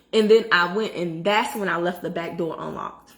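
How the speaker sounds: ordinary talk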